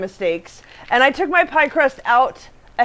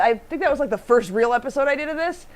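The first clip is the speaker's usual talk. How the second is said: ordinary talk, nothing marked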